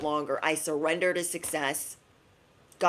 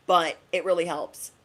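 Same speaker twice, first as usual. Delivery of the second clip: ordinary delivery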